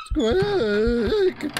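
high squeaky imitation of a creaking door; mock door squeak. Gamer mocking the doors, squeaky creak imitation. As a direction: squeal it like a rusty hinge.

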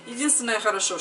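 ordinary delivery

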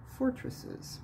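ordinary talk, nothing marked

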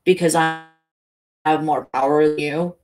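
a hint of a grizzled old cop. A narrator's reading. Helen asserting power through the cop, lightly grizzled. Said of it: "Helen says in the cop's grizzled voice".

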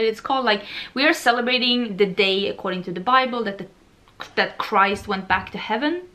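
ordinary delivery

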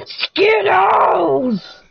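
high, drawn-out mock shout; imitated hyper shout. Player retelling a funny video to friend, hammed-up shout. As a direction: burst out with a silly stretched catchphrase. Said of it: "he turns around and goes".

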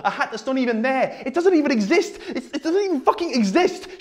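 high-pitched, mounting exasperation; exasperated rant. Acted. Speaker railing at the audience about empty dreams, exasperated. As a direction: let disbelief drive the pitch up.

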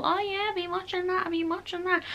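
high, soft pretend familiarity; feigned cool. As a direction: play along as if you're in the know. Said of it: "just feel I'm cool".

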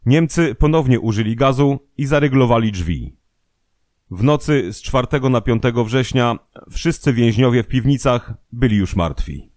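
usual speaking voice

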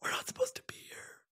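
unvoiced panicky whisper; mock-frightened whisper. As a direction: breathe it like you're trapped. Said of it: "I said".

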